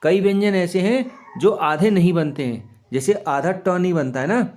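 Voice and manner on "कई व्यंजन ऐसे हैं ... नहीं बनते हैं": normal talking voice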